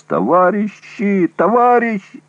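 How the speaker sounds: very high, begging scream; terrified shriek. A narrator's reading. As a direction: shriek in animal terror. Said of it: "взвизгнул умоляющий голос".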